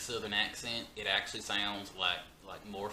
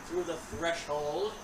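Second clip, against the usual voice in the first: much higher, much louder, hammed-up house tour; spooky tour host; 7 semitones higher, 11 dB louder than usual. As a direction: play the eager guide of a creepy house.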